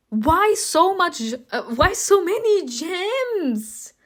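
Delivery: high, drawn-out wail; dismayed disbelief. Real, not acted. Player protests the gem price, high dismayed wail. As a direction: wail the complaint slowly and high.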